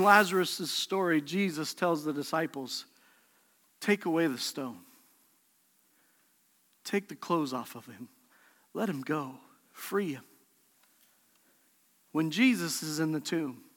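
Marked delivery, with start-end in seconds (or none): 3.8-10.2 softer, lower, gentle orders; hushed command. Acted. Jesus telling the disciples quietly, low and soft. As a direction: quietly tell them to set him free.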